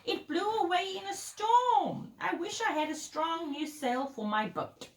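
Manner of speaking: a hint of a lamenting storybook goat; bleating goat. A narrator's reading. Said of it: "who was bleating loudly".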